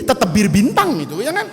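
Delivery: ordinary talk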